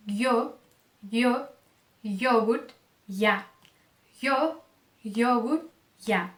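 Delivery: yo, yogurt, ya with a tight glide like a closed I. Shown as a demonstration. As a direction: open yo, yogurt and ya on a narrow Y glide. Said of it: "é um I fechado". (Y como I fechado em yo, yogurt, ya)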